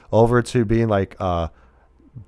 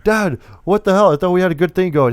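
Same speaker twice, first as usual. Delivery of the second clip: higher-pitched son protesting to Dad; mock protest; 7 semitones higher than usual. Son confronting his father in a film retelling, mock protest. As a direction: imitate an aggrieved son, pitched up.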